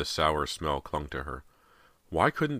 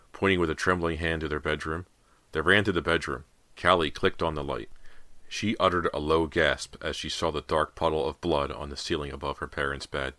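ordinary delivery

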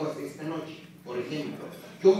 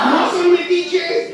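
much higher, much louder question; challenging shout; 11 semitones higher, 9 dB louder, slower than usual. Real, not acted. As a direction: dare the crowd. Emcee challenges crowd.

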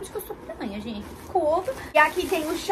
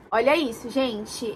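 ordinary delivery

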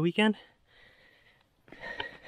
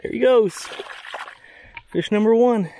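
excited; 9 semitones higher, 9 dB louder than usual. Real, not acted. Releasing a caught fish.

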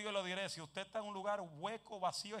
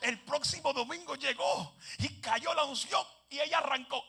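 ordinary talk

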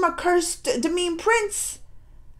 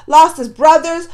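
ordinary delivery

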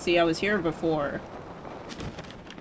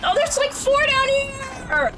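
very high loud shriek; shrieking panic; 3 dB louder, slower than usual. Real, not acted. Gamer seeing four enemies below, very high panicked shriek. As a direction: scream at the sight.

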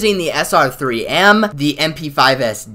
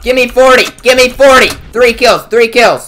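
excited demand; 6 semitones higher, 7 dB louder than usual. Real, not acted. Gamer begging for kills.

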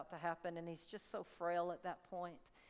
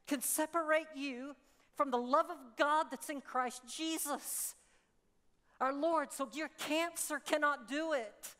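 raised, louder preaching climax; full-force preaching; 6 semitones higher, 4 dB louder than usual. Acted. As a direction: lift it up and proclaim it.

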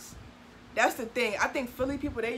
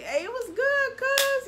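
very high "what's good, cuz"; high friendly call; 6 dB louder, much slower than usual. Shown as a demonstration. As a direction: greet your friend high and bright. Greeting friend, demo.

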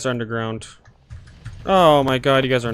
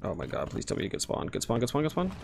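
unvoiced, much softer, lower pleading whisper; whispered plea; 6 semitones lower, 14 dB quieter, faster than usual. Real, not acted. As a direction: beg them under your breath to spawn. Pleading teammate to get spawn.

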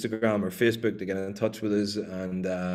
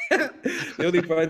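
laughing; much slower than usual. Real, not acted. Laughing after caller's message.

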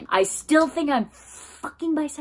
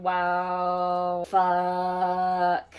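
awestruck. Real, not acted. Still marveling at the memory.

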